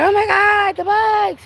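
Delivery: high-pitched comic freak-out over bugs; mock panic. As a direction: squeal it in hammed-up alarm. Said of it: "'Cause I be like".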